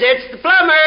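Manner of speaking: high parrot squawk for the punchline; piercing parrot reply. Acted. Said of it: "And the parrot says".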